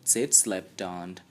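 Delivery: ordinary delivery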